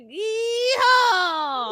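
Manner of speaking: high, drawn-out hammed-up cowboy yell; animatronic bear yeehaw. Host imitating a crusty animatronic bear, hammed-up cowboy whoop. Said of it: "some crusty animatronic, like, bear with a cowboy hat".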